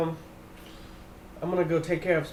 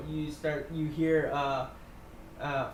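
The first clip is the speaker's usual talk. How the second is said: ordinary talk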